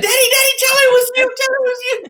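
put-on very high, pleading cry of a scared kid; panicked child. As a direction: beg frantically in a tiny high voice. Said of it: "my daughter's like".

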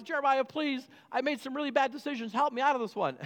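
high, pleading king; begging king. Retold or imitated. Zedekiah begging Jeremiah to get him out, pleading high.

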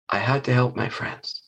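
soft, low determined thought; quiet resolve. Acted. Beaver Face deciding to save friends, quiet resolve. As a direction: think it softly, brave and firm. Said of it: "All she could think of was".

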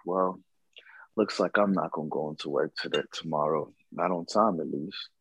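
soft, fading mutter to himself; half-whispered shrug at missing work; resigned whisper. Retold or imitated. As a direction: sigh it out under your breath, giving up. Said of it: "I was like".

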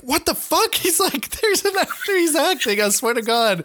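high-pitched incredulous protest; mock freak-out. Friend retells Chuck insisting someone is acting, high mock outrage. Retold freak-out over an actor.

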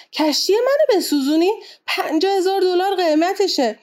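high-pitched, outraged exclamation; indignant shout. A narrator's reading. Captain protesting the burning of his ship, indignant shout. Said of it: "ناخدا داد زد".

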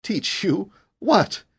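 high, puzzled questions; bewildered, pitch jumping up. A narrator's reading. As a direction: ask as if utterly thrown. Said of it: "he asked in bewilderment".

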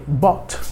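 bought said "bot", short o, no gh; bought, rhymes with dot. Shown as a demonstration. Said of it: "como se fosse B O T".

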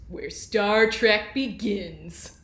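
slow, booming TV promo announcer; UPN announcer voice. Pretend. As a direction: drag it out like a network promo. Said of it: "can I get the, the right, um, U P N announcer voice".

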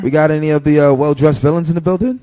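loud, upbeat crowd call-out; animal show trainer. Acted. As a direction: hype up the crowd. Calling a guest to stage.

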